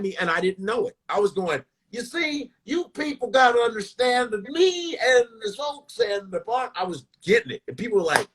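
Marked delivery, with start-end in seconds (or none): 1.9-6.7 higher, drawn-out mock elder-comic voice; Bill Cosby. Comic imitating the headliner behind his back, hammed-up impression. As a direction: ham up a slow, rambling impression. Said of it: "I remember imitating him".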